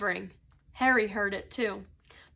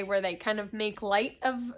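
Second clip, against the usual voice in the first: plain reading voice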